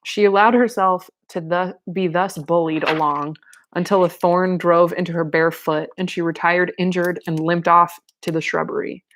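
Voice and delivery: ordinary delivery, nothing marked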